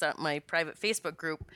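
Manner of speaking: ordinary talk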